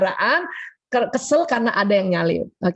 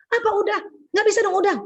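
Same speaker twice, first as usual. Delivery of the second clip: indignant husband; 8 semitones higher, faster than usual. Retold or imitated. Husband retorts in retold quarrel.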